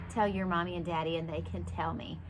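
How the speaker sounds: usual speaking voice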